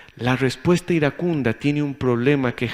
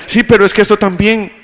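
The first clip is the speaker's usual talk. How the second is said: loud, much higher heated retort; flare of anger; 7 semitones higher, 9 dB louder than usual. Retold or imitated. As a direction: let the irritation burst out. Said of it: "un puño por dentro".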